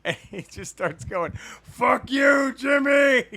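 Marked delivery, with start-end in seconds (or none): 1.6-3.2 loud, high drawn-out yell; screaming drunk heckler. Retold or imitated. Drunk fan on his chair heckling Jimmy Hart, screaming. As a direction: bellow it at the ring like a drunk fan. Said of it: "Like, just screaming".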